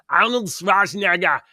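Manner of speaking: loud, fumbled Austrian-accent impression; Arnold Schwarzenegger. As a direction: have a go at a thick Austrian accent. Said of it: "in an Arnold Schwarzenegger voice".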